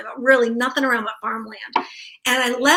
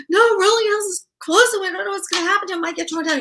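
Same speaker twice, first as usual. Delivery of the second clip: high, upset rush of words; retold crying; 9 semitones higher, 3 dB louder than usual. Telling husband on the phone, mock tearful and high. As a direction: replay the tearful phone call, fast and high. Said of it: "I'm crying when he answers the phone".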